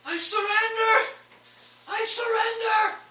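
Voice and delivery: high, loud pleading shout; desperate surrender. Acted. Pseudo kneeling before the party, loud pleading cry. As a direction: beg for mercy on your knees. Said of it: "be like".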